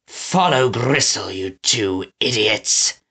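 slow, contemptuous mutter dropping low. A narrator's reading. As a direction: mutter the order at your idiots. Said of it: "muttered Snatcher".

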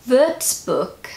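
Würzburg with a German ü, ending like "book"; attempted German pronunciation. Shown as a demonstration. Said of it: "sounded a lot better than how I normally pronounce it".

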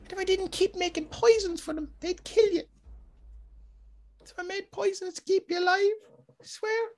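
pleading, very high falsetto; high female character voice. Acted. Woman swears to her husband she protected him, high falsetto. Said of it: "she talk like that".

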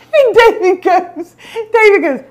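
high, squeezed through laughter; telling it while cracking up; laughing. Real, not acted. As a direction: barely get it out for laughing.

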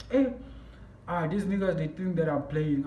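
normal talking voice

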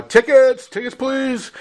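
high calling-out voice, first word loud; retold yell. Conductor calling passengers down the car for tickets, high call. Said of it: "and yelled".

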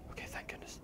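hushed whisper of relief. Real, not acted. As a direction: breathe out your thanks in a whisper. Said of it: "I don't know why I'm whispering again".

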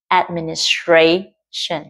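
"Administration" very slow, main stress on tra; slow stress demo. Shown as a demonstration. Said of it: "trong âm ở tra". ("Administration" nhấn âm "tra")

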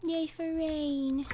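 soft whisper. Real, not acted. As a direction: whisper it quietly. Whispering about rain outside.

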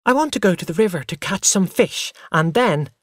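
put-on high, fast, breakneck childish listing; eager child chatter. A narrator's reading. Boy tells mother about the river, eager and fast. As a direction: rattle off plans like an excited boy.

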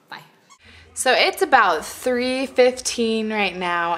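usual speaking voice

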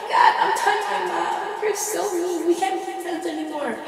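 mock offended, high and whiny; high, whiny hurt friend. Friend told his breath stinks, high and whiny. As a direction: play the hurt friend pitching up in a whine. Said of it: "And he'd be like".

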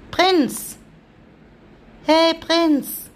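high, loud call with a falling end; calling the cat. Real, not acted. Owner calling the dreaming cat Prinz, high and loud.

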